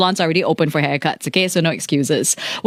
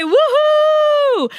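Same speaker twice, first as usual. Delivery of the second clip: much higher woohoo cheer; excited cheer. Retold or imitated. Reading Gwyneth's birthday wish, a high cheer.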